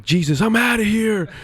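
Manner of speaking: mock-storming off in disgust; fed-up exit. [name] storming out of the green room, exasperated.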